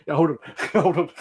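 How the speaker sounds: words broken by real laughter; laughing. Real, not acted. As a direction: laugh through it as you stall. Laughing through retold reply.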